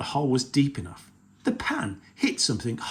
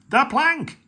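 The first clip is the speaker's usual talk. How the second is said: even higher, loud repeated yell; higher repeated pirate yell for the plank; 14 semitones higher, 7 dB louder than usual. Acted. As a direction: push the chant up a step. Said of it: "yelled Ben Buckle and Percy Patch".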